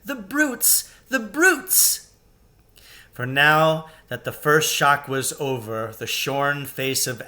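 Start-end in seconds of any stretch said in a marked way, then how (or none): 0.0-2.0 high, tearful cry of outrage; a girl near tears, appalled. A narrator's reading. Lucy crying out at the cruel crowd. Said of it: "tears streaming down her cheeks".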